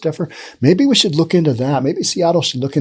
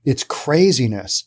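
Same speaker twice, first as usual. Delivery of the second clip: usual speaking voice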